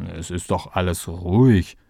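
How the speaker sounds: tiefe, verschlafene Assistentenstimme; verschlafen. A narrator's reading. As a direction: schlaftrunken abwiegeln. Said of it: "Völlig verschlafen". (noch halb im Schlaf)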